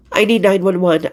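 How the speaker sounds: ordinary delivery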